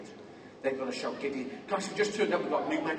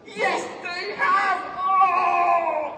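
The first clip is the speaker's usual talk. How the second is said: high, loud, drawn-out cheer; big showman celebration; triumphant reveal; 10 semitones higher, 5 dB louder, much slower than usual. Real, not acted. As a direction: crow it slowly to the crowd.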